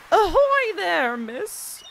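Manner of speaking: loud, slow, drawn-out sailor's greeting; loud ahoy. Acted. First try at greeting the fisherman, loud and drawn out. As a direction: hail him like a ship across the dock. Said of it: "what should I do his voice".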